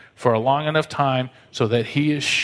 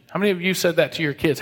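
ordinary delivery

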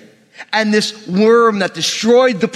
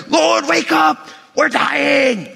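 high, hammed-up cry of panic; panicked disciples; 8 semitones higher, much slower than usual. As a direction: yell like you're freaking out in a storm. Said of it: "they're freaking out".

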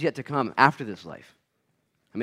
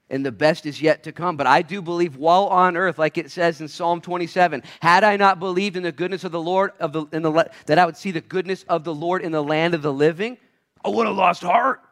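raised, higher preaching voice building in force; impassioned preaching; 5 semitones higher, 5 dB louder than usual. Real, not acted. Preacher quoting a Psalm to the church, impassioned. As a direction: preach it out, building to a loud finish.

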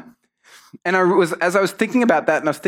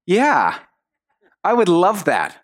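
mock-polite agreement, higher-pitched; polite lie; 4 semitones higher, slower than usual. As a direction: feign delight to please a parent. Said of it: "not tell the truth politely".

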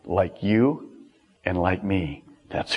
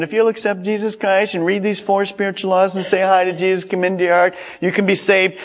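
rattled-off sales pitch, higher and quick; mock advertisement; 4 semitones higher, faster than usual. Preacher mocks an easy-salvation advertisement, rapid pitchman patter. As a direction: parody an ad reader running on one level. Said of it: "sound almost like this advertisement".

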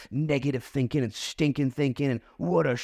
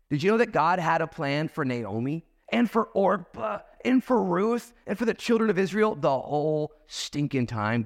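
normal talking voice

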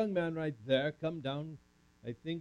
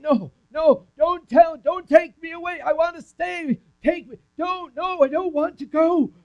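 high, loud frantic pleading; crying out; 14 semitones higher, 11 dB louder than usual. Acted. Joseph begging not to be sold, high frantic shout. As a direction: shout in panic as they drag you off. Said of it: "I cried out to them again".

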